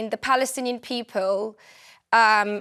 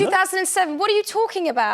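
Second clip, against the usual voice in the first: exasperated retort; 7 semitones higher than usual. Real, not acted. Guest correcting a caller on dates, exasperated and raised. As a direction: cut him off in disbelief. Heated debate, exasperated interruption.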